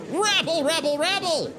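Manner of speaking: high, squealed rabble chant; hammed-up crowd noise; mock crowd uproar. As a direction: imitate a noisy gallery in a high voice. Voices courtroom crowd uproar.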